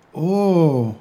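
loud, high, drawn-out oh; big dawning "oh"; sudden realisation. A narrator's reading. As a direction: let the penny drop. Peter suddenly understands.

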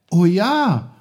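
put-on high, loud boyish exclamation; excited call. A narrator's reading. Peter calls out to his uncle as he understands, excited. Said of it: "rief Peter".

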